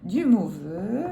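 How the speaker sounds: usual speaking voice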